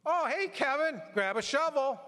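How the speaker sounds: high, loud holler across the barn; farmer calling out. Retold or imitated. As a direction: shout it to the boy across the yard like a busy farmer. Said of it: "what they would tell you".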